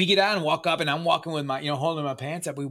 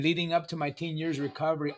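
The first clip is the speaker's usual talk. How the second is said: ordinary talk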